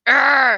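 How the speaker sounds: loud grumpy "arr" growl; imitated growl. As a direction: growl like a sulking, angry boyfriend. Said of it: "he was just like".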